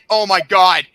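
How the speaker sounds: ordinary delivery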